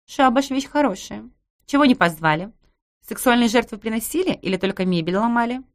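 high, loud teasing neighbour; cheeky neighbour voice. Acted. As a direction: voice the brash neighbour, mocking. Narrator voices Masha.

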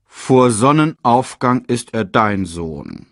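slow, grumbled reply; sleepy grumble. A narrator's reading. Sleepy father hands the cub back to mother, slow grumble. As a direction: grumble it out slowly. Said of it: "grummelte Mufasa".

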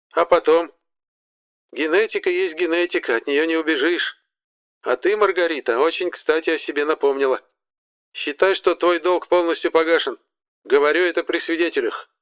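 high, formal elder's voice; stately old woman voice. A narrator's reading. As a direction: pronounce a ruling like a matriarch. Marfa Petrovna pronounces judgement.

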